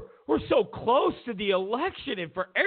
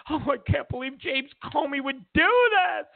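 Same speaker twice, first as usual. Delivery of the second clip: mock outrage; 7 semitones higher than usual. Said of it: "They're gonna be outraged".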